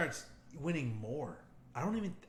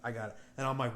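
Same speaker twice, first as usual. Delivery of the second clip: normal talking voice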